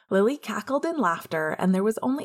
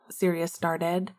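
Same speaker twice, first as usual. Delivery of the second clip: plain reading voice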